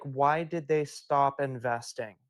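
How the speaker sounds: ordinary talk, nothing marked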